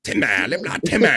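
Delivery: lightly voiced Timmy shout; a hint of Timmy from South Park. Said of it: "trying to get me to do Timmy".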